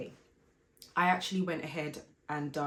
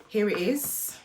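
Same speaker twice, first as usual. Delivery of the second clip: bright, lifted here it is; excited reveal; 6 semitones higher, 9 dB louder, slower than usual. Real, not acted. Vlogger unveils bag box to viewers, excited and louder. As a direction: present the bag like a prize.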